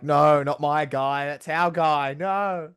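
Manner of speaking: high, drawn-out mock dismay; dismayed fan. Interviewer acting out a wrestling fan watching Brock lose, mock dismay. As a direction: plead slowly like a fan whose hero is losing. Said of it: "were you like".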